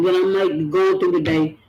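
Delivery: ordinary talk, nothing marked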